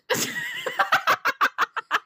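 hoch quietschendes Lachen; hohes Auflachen. Real, not acted. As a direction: lach hoch und laut los. Lautes Auflachen. (hohes, quietschendes Lachen)

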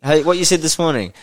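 usual speaking voice

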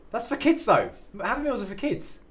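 high, appalled protest; shocked protest. Real, not acted. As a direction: squeak out the objection. Shocked protest about kids.

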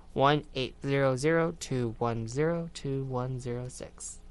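slow, measured digit-by-digit repeat; slow read-out. Real, not acted. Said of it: "I'm gonna read it slow".

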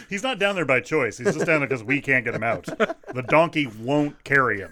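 usual speaking voice

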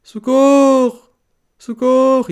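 high loud cry of socorro; desperate yell, high and loud; shouting for help. Retold or imitated. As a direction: scream for help like a trapped kid. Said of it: "comecei a dizer".

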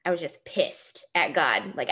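normal talking voice

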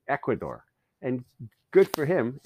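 ordinary talk, nothing marked

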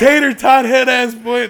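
slow laughing insult; laughing roast. Real, not acted. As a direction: drag out the roast while laughing.

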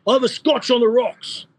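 high, put-on Cypriot Greek accent; excited Cypriot accent, pitched well up; Con's Cypriot accent. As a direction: do the boss in his thick Cypriot accent. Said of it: "the, the Con accent".

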